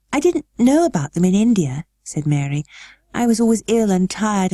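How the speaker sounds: put-on high girlish voice, earnest; a child opening up; girl Mary. A narrator's reading. Mary telling her guardian about India, high and earnest.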